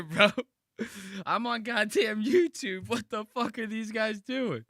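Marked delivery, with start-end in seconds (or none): 1.3-4.6 shocked outburst. Real, not acted. Streamer caught off guard by the screen, shocked outburst. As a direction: exclaim it loudly in high-pitched disbelief.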